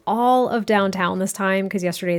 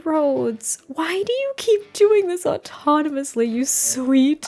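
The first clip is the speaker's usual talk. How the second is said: high, slow, sugary scolding of a naughty sim; mock sweet scolding; 4 semitones higher, much slower than usual. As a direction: coo at the sim like a fond but fed-up owner.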